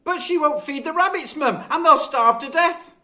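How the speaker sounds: high, loud complaining whine; a child complaining about chores; whining child. A narrator's reading. Children squabble.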